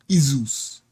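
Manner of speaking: "Izus" softly as EE-zus; right reading. Shown as a demonstration. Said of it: "is pronounced E".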